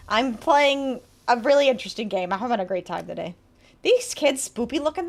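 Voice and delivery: ordinary delivery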